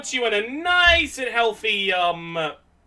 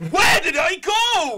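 slow, higher showman question; hammed-up magician; 6 semitones higher, slower than usual. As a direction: ham it up like a stage magician. Magic trick punchline.

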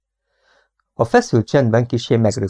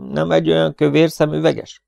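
higher, lively smiling question; 7 semitones higher than usual. A narrator's reading. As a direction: ask it brightly with a smile. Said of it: "kérdezte élénken és mosolyogva". (élénken, mosolyogva)

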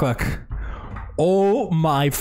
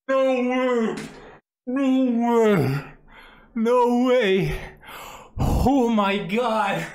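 awed disbelief; 5 semitones higher, 3 dB quieter, slower than usual. Real, not acted. Coming down after the hit.

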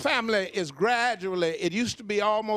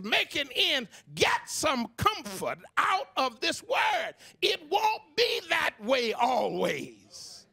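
slow, high preaching with a cry; fervent comforting preaching; 4 semitones higher, slower than usual. Acted. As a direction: lift up the struggling listeners.

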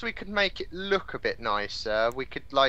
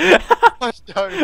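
laughing; 11 semitones higher, 12 dB louder than usual. Real, not acted. Laughing fit.

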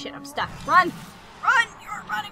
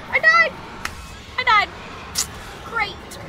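outraged shout; 11 semitones higher than usual. Real, not acted. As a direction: cry out in disbelief. Outrage at dying.

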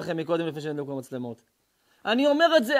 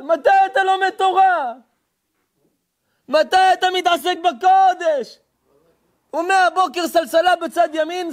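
sustained, drawn-out warning cry; full-force preaching; 10 semitones higher, 9 dB louder, slower than usual. Real, not acted. Preacher warns people off fake kabbalists, thundering. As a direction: preach it forcefully, stretching the phrase ends.

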